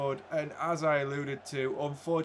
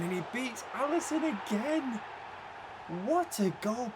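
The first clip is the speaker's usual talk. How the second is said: high-pitched excited goal call; 10 semitones higher than usual. Real, not acted. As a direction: voice leaps high calling the goal. Commentating a goal in the game.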